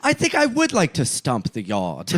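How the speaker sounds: mock British accent. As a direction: announce it in a put-on British accent. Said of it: "a Hugh Grant movie".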